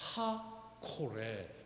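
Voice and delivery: 엔하코레 very slow, in separate pieces; low, soft, careful en, ha, kore; precise pronunciation. Shown as a demonstration. Preacher corrects the funny reading, 엔하코레 slowly separated. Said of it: "이렇게 발음합니다".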